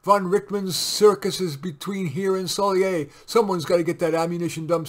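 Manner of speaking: loud urgent soldier. A narrator's reading. Sergeant warning captain in the dugout, loud. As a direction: push it over the shelling.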